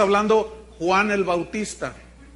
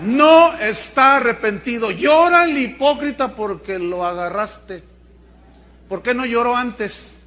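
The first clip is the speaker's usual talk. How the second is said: forceful preaching; 3 semitones higher, 9 dB louder than usual. Real, not acted. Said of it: "preacher denounces the hypocrite".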